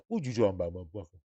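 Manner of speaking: low, dropped-voice disbelieving retort; mock Arnold-style retort. Retorting to the writer in a low mock Arnold voice. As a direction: sink the voice down into a mock comeback. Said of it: "I'm like".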